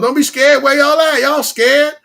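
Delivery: loud, high coaxing call. Real, not acted. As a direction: call out to the viewers teasingly. Calling to livestream viewers.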